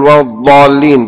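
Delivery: ولا الضالين with a heavy emphatic ḍād; correct ḍād. Shown as a demonstration. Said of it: "بالضاد وليس بالضاء". (الضالين بضاد مفخمة واضحة)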